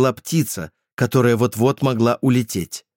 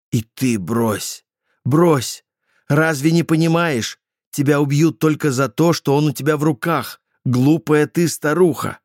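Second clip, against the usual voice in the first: panicked scolding; 6 semitones higher than usual. Acted. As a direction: beg yourself to drop it. (паническая мольба)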